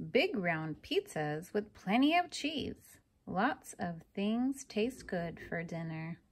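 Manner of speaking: usual speaking voice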